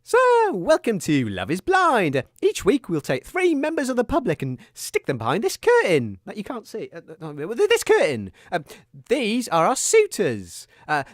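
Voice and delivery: ordinary delivery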